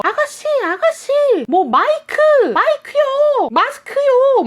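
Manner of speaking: high, loud man yelling from a car window; yelling stranger hammed up high and loud; shouting stranger. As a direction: call out urgently to the driver beside you. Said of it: "소리를 지르면서".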